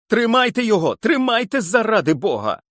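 very high pleading yell; high frantic yell; desperate yell. Acted. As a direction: shriek for help, begging. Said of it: "волав Гарднер".

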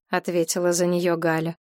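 usual speaking voice

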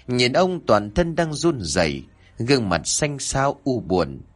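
ordinary delivery, nothing marked

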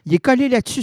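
high, loud call to the pet. Acted. Owner calling the clingy pet to come away, loud and high.

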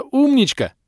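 very high, loud, delighted squeal; gushing praise. A narrator's reading. Kellen praising the rampaging dinosaur, gushing.

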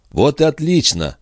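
narrated burst of mild joy; delighted. A narrator's reading. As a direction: react with glad relief. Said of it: "обрадовался Пету".

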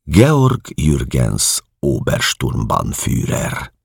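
ordinary delivery, nothing marked

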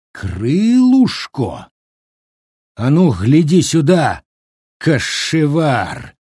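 very slow, drawn-out growling outrage; angry growl. A narrator's reading. Brigade leader confronting the cook, growling. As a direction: throw the word back as a growled challenge. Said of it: "зарычал он".

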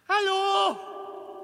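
high call from far off; distant call. Acted. Lost man calls hello into the darkness, distant and high. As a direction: shout it from far away down the tunnel. Calling out in the dark.

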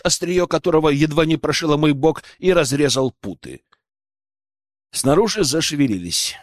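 ordinary delivery, nothing marked

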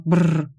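trilled lip shudder; shuddering brr. Acted. Client recoiling from mathematics, shuddering brr.

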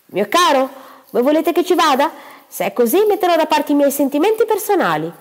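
sugary wife. Acted. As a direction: coax her husband sweetly. Mrs Gibson wheedles her husband. (moglie melliflua, voce più acuta e forte)

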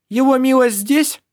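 a high, loud stranger's call. Acted. Stranger calls out in dark.

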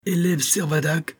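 high, testy schoolmaster's call; irritated voice. A narrator's reading. Old professor addresses his former pupil, irritated. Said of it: "d'une voix empreinte d'irritation".

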